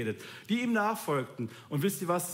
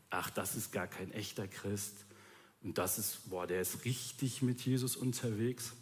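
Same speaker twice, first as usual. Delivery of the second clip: dismissive judging quote; 8 semitones lower, 7 dB quieter than usual. Retold or imitated. Judging others, acted quote.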